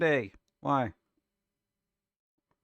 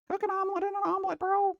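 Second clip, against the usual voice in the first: squeaky high-pitched mockery, rushed; mock the cook in a tiny fast voice; faster than usual. Reacting to a food video, mocking the cook in a squeaky voice.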